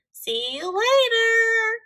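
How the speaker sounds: high, drawn-out calling out; cried farewell. A narrator's reading. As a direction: sing out a long, loud goodbye. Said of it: "he cried".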